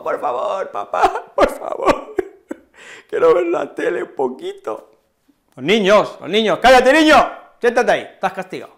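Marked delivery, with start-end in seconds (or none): 0.0-4.9 put-on much higher, breathy, giggly pleading child; child imploring. Punished child begging his dad for TV, high and pleading. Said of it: "El niño le dijo, implorar". 6.6-8.7 louder, higher, fast stern telling-off; scolding father. Acted. Father scolding his punished child, loud and quick.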